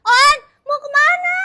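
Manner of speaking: loud, high, drawn-out call; high, stretched-out shout; calling after someone. Acted. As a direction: call out to stop them leaving. Calls after departing boyfriend.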